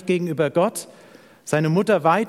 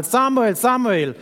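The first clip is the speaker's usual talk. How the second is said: higher, raised call of a name; 6 semitones higher, 4 dB louder than usual. Acted. The Lord calls Samuel a third time, calling out. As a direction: call out, each name arching and falling. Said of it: "rief der Herr zum dritten Mal".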